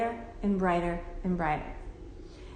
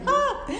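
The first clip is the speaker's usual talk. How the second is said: short high "Ah!" yelp; mock shriek; 4 dB louder than usual. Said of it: "it's like".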